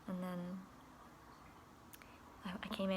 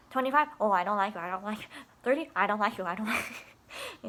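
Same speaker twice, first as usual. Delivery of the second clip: louder, mock-stern teasing refusal; mock rejection; 2 semitones higher, 8 dB louder than usual. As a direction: reject them with fake sternness. Joking age-guess game with kids.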